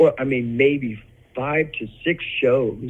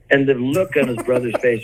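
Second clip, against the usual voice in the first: ordinary delivery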